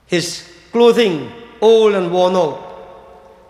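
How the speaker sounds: drawn out very slowly, each word weighed; slow emphatic telling. Acted. Preacher to devotees, painting the priest's poverty very slowly. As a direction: linger on every word of the worn clothing.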